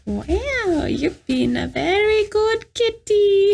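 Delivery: put-on high, slow old witch voice; old woman voice. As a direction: coo like an old crone to her cat. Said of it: "I sound like an old woman".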